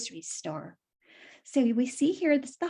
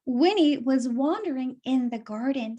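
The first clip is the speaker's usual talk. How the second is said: usual speaking voice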